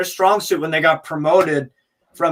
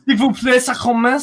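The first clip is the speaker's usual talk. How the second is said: put-on French accent; 7 semitones higher than usual. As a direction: ham up a cartoon Frenchman. Joking French impression.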